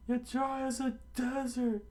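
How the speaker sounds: very high falsetto comeback; high put-on voice; dismissive retort. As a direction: snipe back in a squeaky cartoon voice. Retort in the quarrel.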